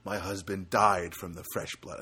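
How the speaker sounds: low, mournful mock widow; gloomy woman. Widow grumbling to the nurse, in a low mock moan. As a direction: imitate her complaining, dropping low. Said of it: "And she goes".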